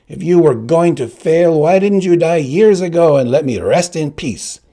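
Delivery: angry father. Acted. The dead father scolding his son, loud and bitter. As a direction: rebuke him with bitter contempt. Father's ghost berates Inigo.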